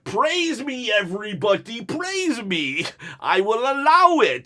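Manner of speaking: high, grandly drawn-out royal proclamation; hammed-up regal decree; haughty queen. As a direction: play a self-important queen granting favours. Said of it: "she's a queen".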